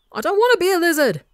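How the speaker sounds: high, loud, fast yell of protest. A narrator's reading. Wilbur protesting to his brother, a high fast yell. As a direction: shriek it in childish outrage. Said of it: "Wilbur yells".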